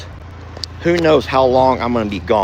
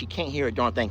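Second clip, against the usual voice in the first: ordinary talk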